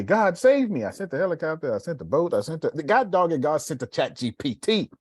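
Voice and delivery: exasperated God. Retold or imitated. Retells joke in God's voice.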